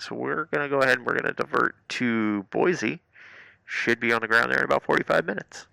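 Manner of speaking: loud airline pilot PA voice; captain announcement. Retold or imitated. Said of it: "we hear the bing".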